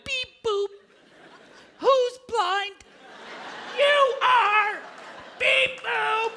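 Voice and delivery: high, taunting beep voice; the signal teasing the blind, sing-song; mocking signal voice.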